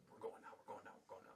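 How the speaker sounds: retold urgent murmur. James insisting quietly to leave.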